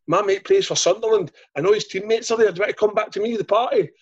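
high excited retold quote; lightly voiced excited mate; imitating his mate. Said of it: "he says".

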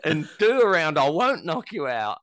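high, loud acted-out challenge; retold psyched-up demand. Psyched-up fighter confronting reluctant opponent in changing room, retold demand. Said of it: "That even psyched him up even more".

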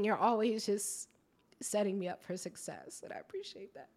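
soft, teary voice, real emotion; holding back sobs while thanking her; tearful thanks. Real, not acted. Guest thanking her friend on air, softly through tears. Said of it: "I don't usually cry on podcasts".

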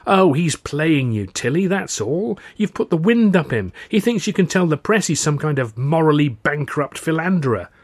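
high, loud, scornful rebuttal; exasperated Arthur. Acted.

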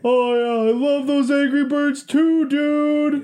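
high, loud fanboy echo; mimicking fan. Passenger echoes the player, high and loud. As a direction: echo the fan's excitement.

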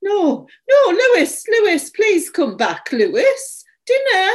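high, loud cry for a lost dog; calling out. A narrator's reading. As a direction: call out desperately for Lewis to come back. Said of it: "I called".

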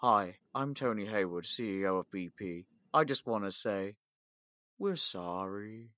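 soft, mock-solemn corporate apology; a quiet, hollow CEO sorry; Tony Hayward apology. Imitating the CEO in the South Park apology video, softly hollow. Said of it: "apology video of Tony Hayward just going".

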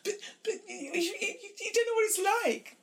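high, laughing mock stammer of distress; mock tearful protest. Mimicking a crying colleague, a high laughing stammer.

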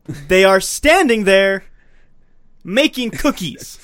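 slow, loud, "standing" punched; indignant emphasis. Real, not acted. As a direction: hammer "standing there" word by word. Repeating the point in outrage.

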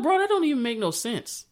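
can't believe what he's reading; incredulous. Retold or imitated. Speaker's own disbelief repeated.

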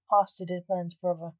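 plain reading voice

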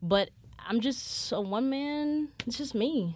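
ordinary delivery

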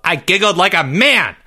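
high, defiant boast; indignant yell. A narrator's reading. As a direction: insist on it at a yell. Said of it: "Jeffrey yelled".

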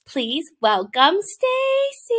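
high, slow, drawn-out welcome; singsong, elongated host welcome; show-host introduction. Real, not acted. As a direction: stretch the guest's name like an announcer. Introducing the podcast guest.

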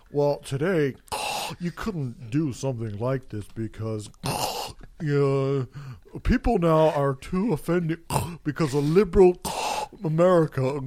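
halting speech broken by loud snorts; mock a self-important talker snorting between phrases. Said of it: "he's snorting like a fucking pig".

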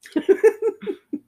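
high burst of laughter; bursting out laughing at the shaky camera; real laugh. Real, not acted. Laughing at the shaky camera in the movie, real laugh.

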